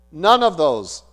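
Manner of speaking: ordinary talk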